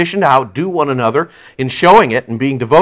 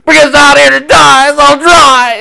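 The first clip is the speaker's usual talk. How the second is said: very high, loud mock wail; hammed-up shrieking complaint, stretched out; whining crowd; 11 semitones higher, 6 dB louder, slower than usual. Thirsty people complaining to Moses in the wilderness, mock wailing. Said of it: "they were like".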